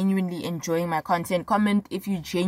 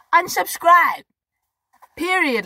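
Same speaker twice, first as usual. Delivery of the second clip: unsubscribe, high and loud with attitude; a loud, high, sassy send-off; sassy dismissal; 9 semitones higher, 5 dB louder, slower than usual. Real, not acted. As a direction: throw it at them with sass. Sassy dismissal of offended viewers.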